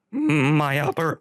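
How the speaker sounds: slow, inhaled robot voice; half-done text-to-speech robot; robot voice attempt. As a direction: breathe in while talking like a robot. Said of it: "Stephen Hawking type robot voice".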